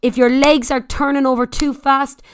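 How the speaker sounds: ordinary talk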